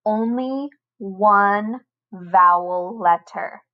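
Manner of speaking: only one vowel letter, one slow and leaned on; slow down and hit one; stress on one. Shown as a demonstration. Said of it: "teacher stresses key rule".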